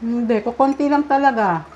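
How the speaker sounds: speaking with food in mouth; words muffled by chewing; talking while chewing. Real, not acted. Seen 0: Woman chews steadily with fork in hand; unsure which diner speaks.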